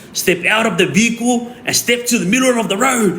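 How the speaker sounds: high, projected mock command; retold police order. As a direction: imitate an officer ordering someone out.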